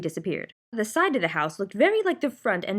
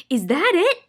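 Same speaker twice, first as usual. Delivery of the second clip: excited shout, higher and louder; 9 semitones higher, 6 dB louder than usual. A narrator's reading. Milo spotting the shining city, shouting. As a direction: call out running toward it. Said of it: "shouted Milo".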